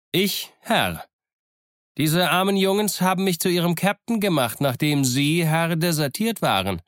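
high, animated pirate voice; Long John. A narrator's reading. Pirate explains his captaincy to Smollett, high-voiced. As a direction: answer up in a high, pointed voice. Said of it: "Long John antwortete selber".

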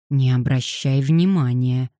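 ordinary talk, nothing marked

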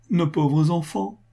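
high, drawn-out maternal lament; heartrending cry. A narrator's reading. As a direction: stretch it into an anguished plea. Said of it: "s'écria Sophie d'une voix déchirante".

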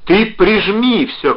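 high, slow, emphatic yell; loud, stretched-out cry; shouting. A narrator's reading. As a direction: shout instructions down a bad line. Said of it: "кричал он".

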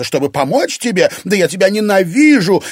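high, loud burst of loathing; hateful outburst. A narrator's reading. Irina turning on her rival, hateful outburst. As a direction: build to a loud cry of hatred.

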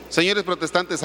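ordinary talk, nothing marked

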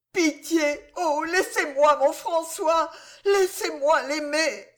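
high, anguished begging; desperate pleading. A narrator's reading. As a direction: implore him for your love. Wife begs to keep husband.

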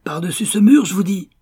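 loud, high call across the garden; shouted. Acted. Pursuer yelling to his partner about the wall, loud shout. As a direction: yell it out in pursuit. Men shouting during a chase.

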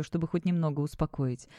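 ordinary delivery, nothing marked

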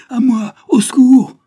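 loud, high, drawn-out scream for help; cry for help. A narrator's reading. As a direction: scream desperately to be rescued. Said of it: "Elle voulut crier". (appel au secours hurlé)

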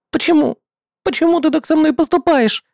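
high, desperate wail. A narrator's reading. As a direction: cry out while bound. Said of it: "вопила пленённая Сетеплёт".

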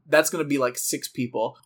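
usual speaking voice

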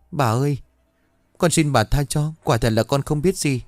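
high, tearful begging for mercy; a frightened maid pleads, voice high and near tears; tearful pleading. A narrator's reading. Maid begs her mistress for mercy, high and tearful. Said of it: "khóc lóc van xin".

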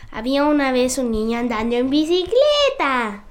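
ordinary talk, nothing marked

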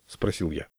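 ordinary delivery

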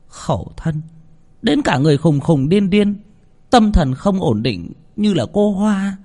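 high, pitying mother's voice; mother's lament. A narrator's reading. Mother telling of the drowned woman, pitying and high.

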